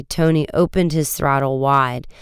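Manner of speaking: usual speaking voice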